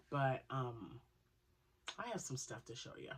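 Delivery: ordinary delivery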